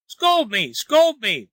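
high, falling pleas; begging. A narrator's reading. Wang begs Lin to scold him, desperate pleading. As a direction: plead urgently, pitched well up. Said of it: "begged Wang".

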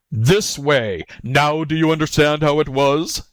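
exasperated tiger cry; fed-up beast proving a point; lost patience. A narrator's reading. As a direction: demand it in rising irritation. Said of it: "the tiger lost patience".

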